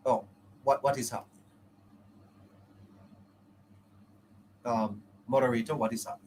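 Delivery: cutting out on a bad connection; laggy video call. Real, not acted. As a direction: voice dropping out over the call. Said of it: "did you have a power cut?".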